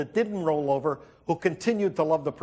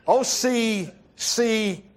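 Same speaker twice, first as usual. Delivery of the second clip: very slow, unsure letter by letter; hesitant spelling; 4 semitones higher, much slower than usual. Retold or imitated. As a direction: spell it out like a doubting doctor. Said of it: "he got indecision".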